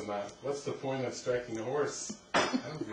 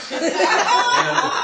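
laugh; 15 dB louder than usual. Real, not acted. Bursting into laughter mid-story.

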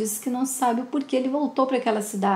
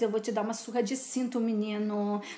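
much softer, fast menacing threat; quiet threat; 3 semitones lower, 8 dB quieter than usual. Acted. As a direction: hiss the threat low and quick. Mother threatens son with belt. (ameaça baixinha e rápida)

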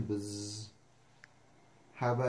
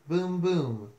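higher, louder booms; thunder booms; 4 semitones higher, 5 dB louder than usual. Acted. Reader reviews the thunder sound, booming loudly. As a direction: boom it out like thunder. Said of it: "Mister Brown makes thunder".

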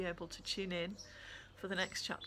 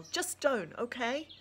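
raised angry warning; 5 semitones higher, 5 dB louder than usual. A narrator's reading. Girl to Claire, angry and raised. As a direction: push her off with anger. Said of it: "I growl".

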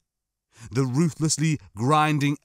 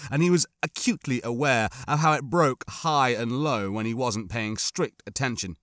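plain reading voice

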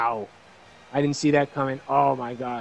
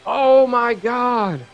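slow, drawn-out, high and loud; stunned disbelief at the finish; astonished; 8 semitones higher, 7 dB louder, much slower than usual. Real, not acted. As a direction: let the shock stretch out. Stunned by knockout.

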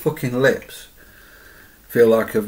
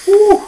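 whoop of pain; 9 dB louder than usual. Real, not acted. Reacting to burning chili.

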